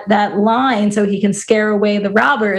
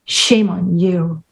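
slow, low mock scolding; retold woman's retort; 5 semitones lower, much slower than usual. Woman turning his scolding back on him, low slow mock retort. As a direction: throw the rebuke back, lower and drawn out. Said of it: "And she says".

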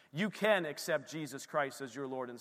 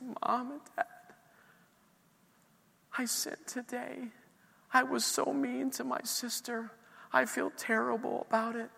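put-on higher, small child's voice, remorseful; daughter confessing; 8 semitones higher than usual. Father voicing his young daughter confessing to her parents, high and remorseful. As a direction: voice a little girl owning up.

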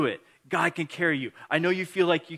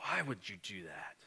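hushed, lower mock disapproval; indignant judging; 5 semitones lower, 11 dB quieter than usual. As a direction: question it softly, in judgment. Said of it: "indignant, judging".

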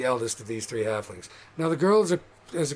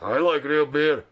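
loud, rough orc voice; rowdy orc; 2 semitones higher, 5 dB louder than usual. Acted. Orc in the crowd answering the halfling, rowdy shout. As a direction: blurt it out like an indignant orc. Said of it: "And like".

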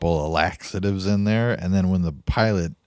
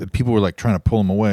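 ordinary delivery

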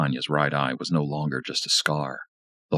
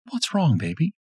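higher woman's voice, purring; Lisa seductive; 13 semitones higher than usual. A narrator's reading.

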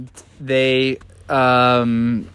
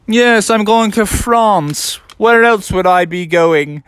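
higher, slow, lofty disguise voice; Pierre Frogerson; 6 semitones higher, 4 dB louder than usual. Acted. Disguised Froggy telling teacher about vacation, put-on lofty voice. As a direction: answer grandly as the fake newcomer. Pierre claims going to France.

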